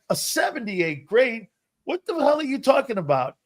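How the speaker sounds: high-pitched, incredulous retort; acted-out indignant question; indignant disbelief. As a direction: protest an unfair grade in mock outrage. Retold protest at a low grade.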